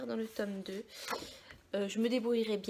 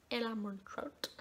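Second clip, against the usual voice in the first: retry Armentrout in a self-mocking English accent; English accent attempt; 6 dB quieter, slower than usual. Shown as a demonstration. Booktuber retrying the author's name for viewers, clumsy English accent. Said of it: "mon super accent anglais".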